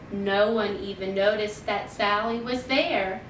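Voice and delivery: usual speaking voice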